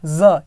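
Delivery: "ज" as a low buzzed z; isolated z sound. Shown as a demonstration. Teacher pointing out the sound inside wise, separate z. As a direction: sound out "ज" alone as z. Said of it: "ये sound निकाला".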